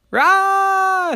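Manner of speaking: very high, loud shriek; big person's scream. A narrator's reading. Big person seeing so many monsters, terrified scream. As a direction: scream at a doorstep full of monsters.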